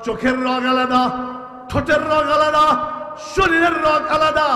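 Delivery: from plain to high and loud, climbing each line; building preaching. Acted. Preacher marvelling at the body's design, building to loud. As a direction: build the list up to a roar.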